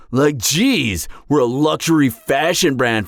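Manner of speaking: ordinary talk, nothing marked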